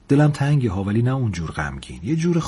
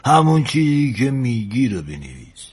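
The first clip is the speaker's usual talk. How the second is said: put-on slow, rhythmic listing in an old sailor's voice; animated old engineer dictating a love letter; old engineer, coaxing. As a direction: roll out example after example for the lad.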